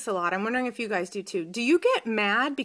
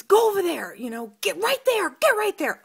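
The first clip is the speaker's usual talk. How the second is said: much higher, louder mock yell; imitated coach yelling; 11 semitones higher, 5 dB louder than usual. Assistant coach yelling in Damien's face, loud mock yell. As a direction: bark it in the boy's face like the coach. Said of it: "in Damien's face".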